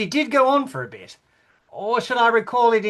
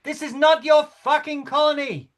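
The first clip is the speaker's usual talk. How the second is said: higher, sharp final outburst; angry outburst; 7 semitones higher than usual. Acted. King ends his imitation of the queen with an angry outburst. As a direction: spit the last accusation. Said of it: "perform it as if I were her".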